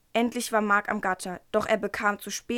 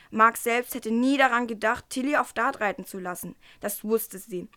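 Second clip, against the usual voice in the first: ordinary delivery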